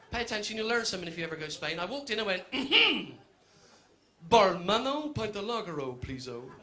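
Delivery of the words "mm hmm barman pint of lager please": hammed-up fake Spanish endings on English words; mock Spanglish. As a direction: order a pint in comic pidgin Spanish. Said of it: "in perfect Spanglish".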